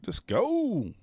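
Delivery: very high, thin pleading voice; squeaky pleading. Pretend. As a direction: beg it in a tiny high squeak.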